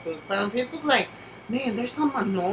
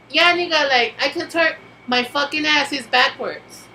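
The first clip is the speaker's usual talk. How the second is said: higher, louder sarcastic comeback; sassy retort; 5 semitones higher, 5 dB louder than usual. Retold or imitated. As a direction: throw it back with mock attitude. Mocking reply about twerking.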